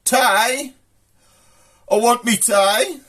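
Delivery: high, drawn-out demand; hammed-up bloke wanting his meal; Ian. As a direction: call for it high and slow. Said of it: "It's a bloke called Ian".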